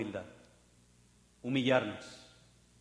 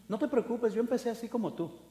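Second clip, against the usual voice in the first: condescending; 6 semitones higher than usual. Retold or imitated. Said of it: "Casi hasta menospreciando".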